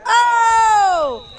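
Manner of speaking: sudden high, loud shout of excitement; a burst of goal-call joy; excited shout. Real, not acted. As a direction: yell as the goal goes in. Goal scored during live commentary.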